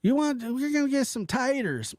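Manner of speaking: high country grandma voice; lightly hammed-up Okie grandma; imitating grandma. Quoting her country grandma about taters, high and folksy. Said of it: "she goes".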